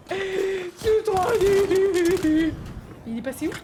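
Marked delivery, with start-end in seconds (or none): 0.1-2.5 very slow, loud whine; loud frustrated complaint dragged out; drawn-out frustrated wail. Real, not acted. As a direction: stretch it out in disgust. Frustrated at bad game.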